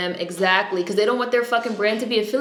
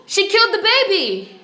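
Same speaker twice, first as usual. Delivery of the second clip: high, loud, real disbelief; horrified exclaim; 11 semitones higher, 5 dB louder than usual. Real, not acted. Telling the stream what happened, high loud horror. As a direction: cry it out, stunned. Shocked while watching a show.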